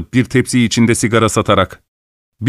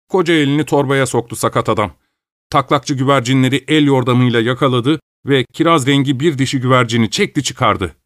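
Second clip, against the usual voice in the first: plain reading voice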